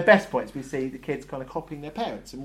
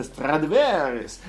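Stradivarius said with a hammed-up Italian accent, louder; 2 semitones higher, 9 dB louder, slower than usual. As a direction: roll out Stradivarius like a mock Italian. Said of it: "says the word Stradivarius in an Italian accent".

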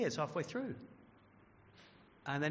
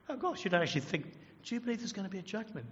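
higher-pitched scoffing colleague; mock incredulous; 6 semitones higher, faster than usual. Colleague at work mocking a believer, incredulous. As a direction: act out disbelief at such an old idea.

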